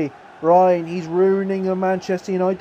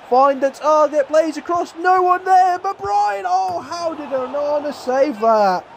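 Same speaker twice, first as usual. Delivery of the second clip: excited commentary; 8 semitones higher than usual. Real, not acted. Commentating his own attack on goal, rising excitement. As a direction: call the attack climbing higher and higher.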